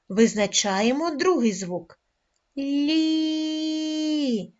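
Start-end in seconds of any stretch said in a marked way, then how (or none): 2.6-4.5 Лі with the і held long on one raised note; drawn-out і. Shown as a demonstration. Teacher showing the second sound, long held і in Лі. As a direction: stretch the vowel of Лі evenly. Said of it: "Ми тягнемо звук І".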